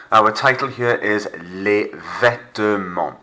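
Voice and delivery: Vêtements said slowly with silent T and silent S; slow French Les Vêtements, the T and S silent; Vêtements voiced as vet-mon, no T, no S; French pronunciation. Shown as a demonstration. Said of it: "Silent T, silent S".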